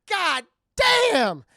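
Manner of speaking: very loud, high, drawn-out words; mock awestruck yell. Jackie and Chris seeing the body explode, astonished yell. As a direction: bellow it in exaggerated astonishment. Acted reaction to gory fall.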